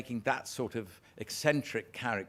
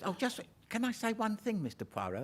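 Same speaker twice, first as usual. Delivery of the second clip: put-on higher, softer old lady; 11 semitones higher, 3 dB quieter than usual. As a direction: ask it sweetly like a little old lady. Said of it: "she says".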